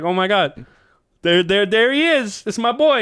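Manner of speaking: high, excited fan cheer; proud cheering. Retold or imitated. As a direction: cheer on your boy from the front row. Acts out relatives cheering for him.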